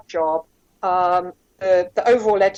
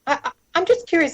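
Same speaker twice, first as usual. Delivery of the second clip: faux-innocent curiosity; 8 semitones higher, faster than usual. Boss slyly fishing for name.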